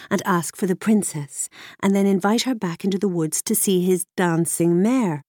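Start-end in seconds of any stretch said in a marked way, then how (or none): none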